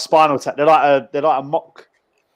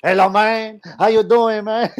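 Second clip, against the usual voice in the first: imitating the stripped-off performer's greeting, higher and showy; 7 semitones higher than usual. Telling mates how the naked performer greeted [name], in a hammed-up higher voice. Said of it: "He goes".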